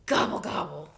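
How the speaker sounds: low grumbled gobble; low, sulky turkey gobble. A narrator's reading. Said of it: "grumbled Turkey".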